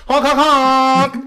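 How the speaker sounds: slow, loud, high honking sounds; imitated car horn. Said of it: "I honked my horn".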